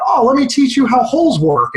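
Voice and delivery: retold mild yell. A teacher to a man stuck in a hole, mild mock yell. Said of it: "A teacher yelled down".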